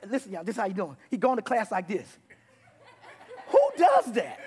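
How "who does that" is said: high, loud mock outrage; exasperated punchline yell; incredulous shout. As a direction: holler it in comic disbelief. Punchline of acted mockery.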